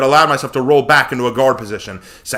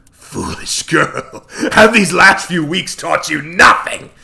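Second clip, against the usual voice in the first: scornful villain; 4 semitones higher, 3 dB louder, slower than usual. Acted. Set scolds the heroine.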